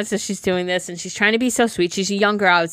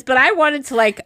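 ordinary talk, nothing marked

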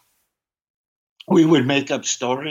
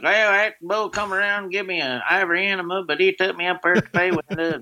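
goofy Southern cowboy voice pitched up, quick; Southern rodeo guy; 6 semitones higher, faster than usual. Retold or imitated. Comic at the mic as the rodeo guy, Southern accent. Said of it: "I'd be like".

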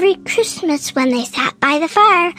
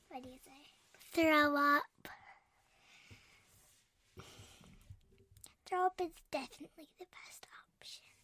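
quiet; 17 dB quieter than usual. Real, not acted. Quiet chat after show.

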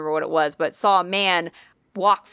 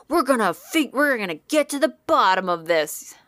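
mock-earnest investigator, pushed a little higher; Zach; 4 semitones higher than usual. Host imitating Zach on arrival, mock determination. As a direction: put on a determined ghost hunter. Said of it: "he's just like".

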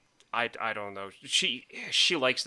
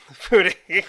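louder, higher, breaking into laughter; laughing; 8 semitones higher, 8 dB louder than usual. Real, not acted. Friend joking about ancestral food, laughing. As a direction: say it through a real laugh.

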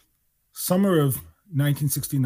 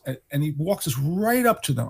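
normal talking voice

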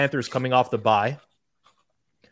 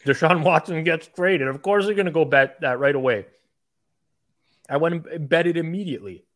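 excited; 6 semitones higher, 4 dB louder than usual. Real, not acted. Excited retelling of his bet.

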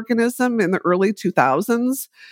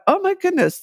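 surprised exclamation; 13 semitones higher than usual. Retold or imitated. Recalls shock at bacteria name.

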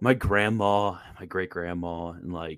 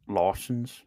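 mock Australian accent. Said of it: "Oh, yeah, not quite".

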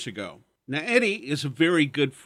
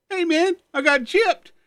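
very high, louder cry of dismay; dismayed friend; 13 semitones higher, 6 dB louder than usual. Retold or imitated. Eddie finding his puff empty, high and indignant. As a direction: squeal like you've been cheated.

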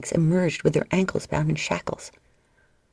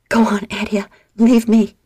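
louder, higher, urgent plea; desperate plea; 4 semitones higher, 6 dB louder than usual. A narrator's reading. Injured Livia begging her sister to leave, urgent. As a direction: push her away to save her.

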